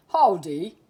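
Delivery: loud, folksy cowboy greeting; a hearty lawman's hello; sheriff's howdy. A narrator's reading. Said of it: "he said".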